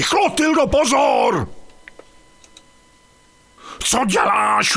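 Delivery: a sudden high yell of warning; alarmed shout. Acted. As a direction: cry out to stop her in time. Near miss, warning his wife.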